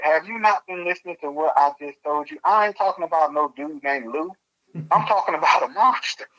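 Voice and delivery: high, outraged can't-believe-it reply; incredulous. Retold or imitated. Retelling her confused reply to the coworker, high and incredulous.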